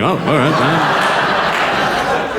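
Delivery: slow, deflated laugh through words. Retold or imitated. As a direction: mumble it out while laughing. Deflated reply after insult.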